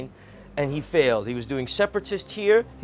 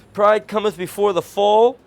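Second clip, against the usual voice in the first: louder, higher solemn declaration; loud proclamation; 4 semitones higher, 7 dB louder than usual. Real, not acted. Closing his rant on Putin, loud solemn pronouncement. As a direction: ring it out like a verdict.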